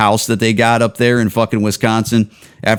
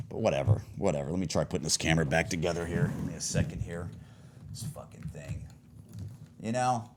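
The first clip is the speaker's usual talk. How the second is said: much softer, half-heard grumbling to himself; muttering to himself; 8 semitones lower, 20 dB quieter, faster than usual. Real, not acted. Vlogger grumbling to himself, much softer, while fixing his camera. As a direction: mumble it away from the mic while fixing the camera. Fiddling with camera, talking to self.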